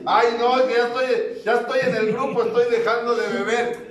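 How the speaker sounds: high, loud pleading whine; whining plea. Retold or imitated. The drunk begs the collector, high loud whining plea. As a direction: beg in a high panicky whine.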